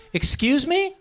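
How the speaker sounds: high questioning excuse me, rising on ME; didn't hear you. Shown as a demonstration. Comic showing someone who didn't hear, questioning. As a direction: ask for a repeat with a light lift at the end. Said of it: "You didn't hear what they said".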